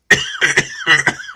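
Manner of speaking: hard choking cough fit. Real, not acted. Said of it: "choking my fucking face off".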